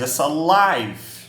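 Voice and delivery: slow, live said "laiv". Shown as a demonstration. Teacher correcting himself to the class: slow "laiv". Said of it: "aliás, aliás".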